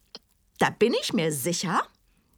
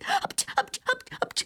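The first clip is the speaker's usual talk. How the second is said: high straining hen grunts; egg-laying strain. Acted. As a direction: push out an egg with squeaky grunts.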